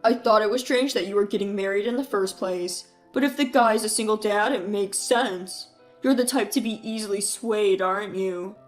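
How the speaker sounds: drip sarcasm on the good for you; mocking brother. Brother taunts the groom about being a single dad, sneering. Keith belittles Mark.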